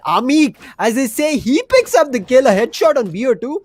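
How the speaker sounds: high, loud, punchy announcement; excited kill call. Real, not acted. Caster hailing a struggling player's kill, excited. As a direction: lift the voice and celebrate the pick.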